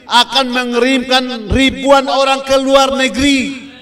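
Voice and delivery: loud, high-pitched prophetic proclamation; full-force preaching. Real, not acted. Preacher promising thousands sent abroad, impassioned high shout. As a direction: ring the promise out to the whole congregation.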